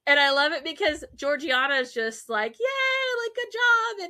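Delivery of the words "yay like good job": high little mock cheer; imitated cheering. Georgiana praising the pianist, imitated gleeful cheering.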